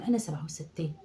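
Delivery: breathy whispered aside, voice dropped away; mock whisper. Old mother whispering her real age to herself, mock secretive whisper. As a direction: whisper it under your breath.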